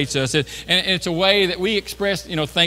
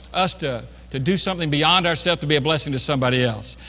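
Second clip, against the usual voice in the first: normal talking voice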